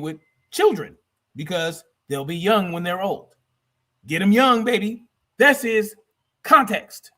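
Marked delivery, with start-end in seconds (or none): none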